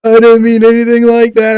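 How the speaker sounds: high, loud imitated wail; mock crying. Drunk man apologizing to officer, mock crying. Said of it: "he starts crying on me".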